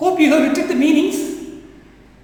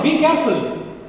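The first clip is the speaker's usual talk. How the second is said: normal talking voice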